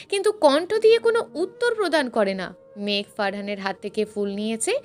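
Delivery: ordinary delivery, nothing marked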